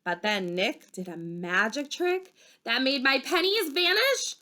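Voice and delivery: plain reading voice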